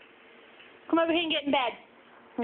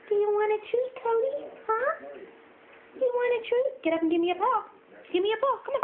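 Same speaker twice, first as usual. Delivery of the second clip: much higher sing-song pet voice; talking to a dog; 11 semitones higher, faster than usual. Real, not acted. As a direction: coax the dog, high and eager. Coaxing dog with treat.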